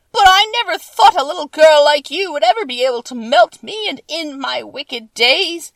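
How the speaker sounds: a dying witch's wailing farewell; melting witch wailing. Acted. Witch melting, lamenting to Dorothy.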